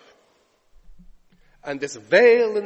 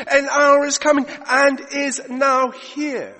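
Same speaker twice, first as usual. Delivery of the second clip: louder, ringing preacher's voice; preaching at full force; 6 semitones higher, 6 dB louder than usual. Acted. Preacher proclaiming Jesus' words to the Samaritan woman, full force. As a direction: proclaim it to the congregation.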